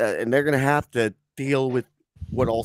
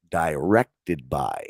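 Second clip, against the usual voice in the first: mock credit read; 9 semitones lower, much slower than usual. Mock-reading a show credit.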